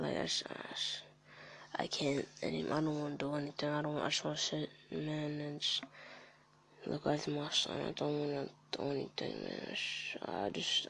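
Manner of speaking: real dejection. Real, not acted. Depressed father admitting he wants to do nothing, dejected. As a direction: let it sink away quietly, halting between phrases. Confessing his mental health is bad.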